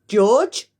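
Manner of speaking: loud call of the name; shouting. Acted. Mister Cranky shouting for George, loud. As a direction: call out to the boy loudly. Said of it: "Mister Cranky shouted".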